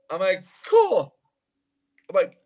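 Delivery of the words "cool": very high, louder one-word reply; squeaky acceptance. Retold or imitated. Drummer answering the singer's offer, high squeaky agreement. Retelling his stunned acceptance.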